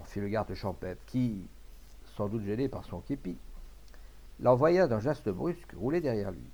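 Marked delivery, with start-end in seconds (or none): none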